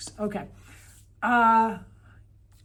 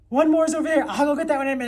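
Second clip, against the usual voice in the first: high, loud burst of realisation; excited discovery; 7 semitones higher, 9 dB louder, much faster than usual. Real, not acted. Teacher spots the missing tenth book, excited. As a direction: call it out on spotting it.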